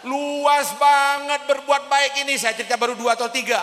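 loud, high exclamation, first words stretched; forceful preaching. Real, not acted. Preacher exclaims to young audience. (berseru lantang, awalnya dipanjangkan)

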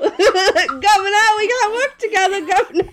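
laughing mock plea. Silly dramatic plea through laughs.

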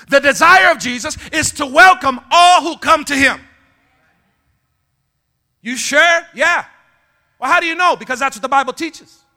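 high, loud, challenging preacher voice; full-force preaching. Real, not acted. Preacher challenging congregation on welcoming all, full-force preaching. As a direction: press the congregation for an answer.

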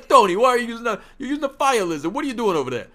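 exaggerated Brooklyn Italian wise guy. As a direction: ham up a Brooklyn Italian gambler calling out. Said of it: "like, Brooklyn Italian accents".

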